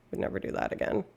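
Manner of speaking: under the breath, a whispered aside; whispered bitter aside. Real, not acted. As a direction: mutter it to yourself, barely voiced. Bitter aside about French ex.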